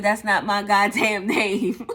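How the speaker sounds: loud, slow, annoyed retort with a laugh; loud, slow mock outrage at being named; exasperated outburst. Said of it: "I'm like".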